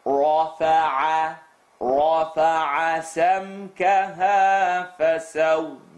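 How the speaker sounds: رفع slow with a clear ah vowel; clear open ah. Shown as a demonstration. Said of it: "I want to hear the ah".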